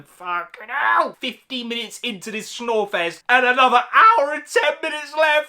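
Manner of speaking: usual speaking voice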